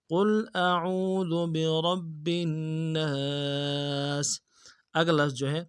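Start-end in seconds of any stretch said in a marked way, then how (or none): 0.1-4.4 slow melodic tajweed recitation; Quran recitation. Shown as a demonstration. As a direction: chant it slowly with full tajweed. (قرآن کی تلاوت، آہستہ اور سریلی)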